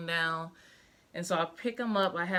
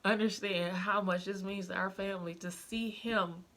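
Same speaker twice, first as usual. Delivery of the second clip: retold tearful plea; 2 semitones higher than usual. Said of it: "bawled like a baby in her office and was just like".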